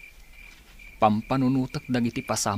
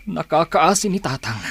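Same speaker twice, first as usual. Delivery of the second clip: louder, higher pained outcry; anguished cry; 6 semitones higher, 7 dB louder than usual. Acted. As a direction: break out over his father's pity. Grieving over his father. (nasaem nga ikkis)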